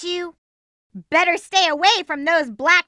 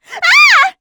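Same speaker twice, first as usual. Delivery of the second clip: cry; 9 dB louder than usual. Acted. Startled cry.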